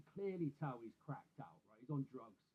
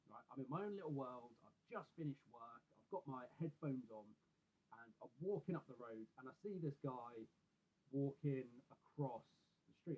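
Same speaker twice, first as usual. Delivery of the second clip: ordinary delivery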